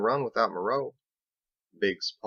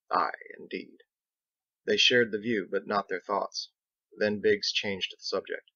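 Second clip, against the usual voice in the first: usual speaking voice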